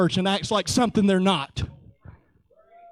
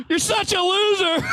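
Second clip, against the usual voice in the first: teasing jab; 7 semitones higher than usual. Real, not acted. Teasing Michael.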